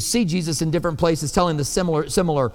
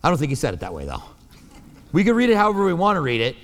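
ordinary delivery

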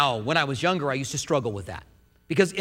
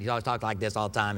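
imitated Maine accent, lower and softer; northeastern US accent; 9 semitones lower, 5 dB quieter, faster than usual. Preacher mimicking his friend from Maine, northeastern accent. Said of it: "He's got that northeastern accent".

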